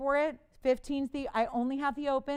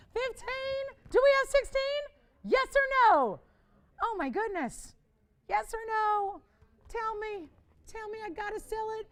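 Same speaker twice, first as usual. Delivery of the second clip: high, urgent pleading for one more bid; pleading excitement; 9 semitones higher, 4 dB louder than usual. Real, not acted. Host begging operators for a higher bid, high and urgent. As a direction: beg the callers, pitch way up.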